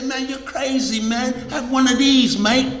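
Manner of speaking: a light put-on Australian cockney, mocking a friend; Australian cockney lilt, teasing. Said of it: "Some Australian cockney".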